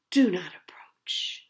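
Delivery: soft whispered do not approach; whispered warning. A narrator's reading. Hacker reading the police file, whispered. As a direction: hush it like a secret warning.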